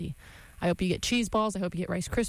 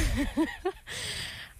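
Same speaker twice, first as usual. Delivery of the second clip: high giggle; 9 semitones higher, 3 dB quieter than usual. Real, not acted. Laughing before signing off.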